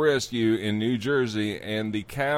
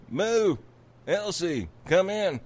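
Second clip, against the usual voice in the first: high, drawn-up call to a cow; owner calling; 5 semitones higher than usual. Acted. Owner calling Elsie in from the woods, high call. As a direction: sing out for the lost animal, pitched up. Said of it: "and the owner's out there".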